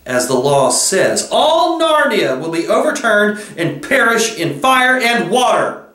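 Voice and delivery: high, imperious witch voice; menacing witch. A narrator's reading. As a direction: threaten doom as the haughty witch. Witch threatens Aslan's followers.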